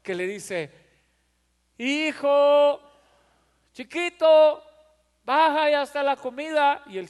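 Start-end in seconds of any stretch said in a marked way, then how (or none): 1.8-6.8 high, loud sweet call upstairs; loving mother calling. Retold or imitated. Mother lovingly calling her son down to eat. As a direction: coax the little one down, lovingly. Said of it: "mamá en su benignidad lo ama tanto".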